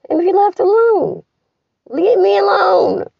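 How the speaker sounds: desperate high yell, slowing on leave me alone; anguished shouting. Real, not acted. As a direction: scream it out, at the end of her rope. Breakdown, shouting at everyone.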